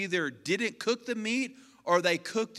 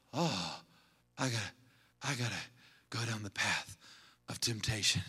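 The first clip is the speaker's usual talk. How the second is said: a lightly voiced, lower, softer put-upon whine; mock tempted sigh; 10 semitones lower, 8 dB quieter than usual. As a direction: play the weakling giving in to temptation. Mocking a tempted, weak believer.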